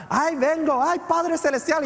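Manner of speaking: high-pitched mock panic of a praying mother; imitating alarmed mom. Mom running from the garage to her child, high alarmed cry. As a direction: cry out high like a frantic mama.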